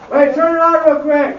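loud high shout down the hall; calling out loud. Real, not acted. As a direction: call across the building to the helper.

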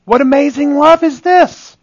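high-pitched preacher's exclamation; rising cry of amazement; exultant wonder. Real, not acted. Preacher exclaims at amazing love.

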